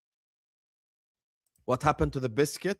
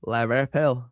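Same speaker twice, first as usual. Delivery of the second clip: mock Irish pronunciation, lower; lightly teasing Irish accent; 4 semitones lower than usual. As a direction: imitate her Irish "Liverpool", like "Lavverpel". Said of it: "that's how she says it".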